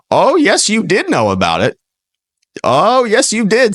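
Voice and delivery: high, singsong oh yes you did; high mocking taunt aimed at Blinken; sarcastic contradiction. Real, not acted. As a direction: call his bluff with a taunting lilt. Host contradicting Blinken's denial.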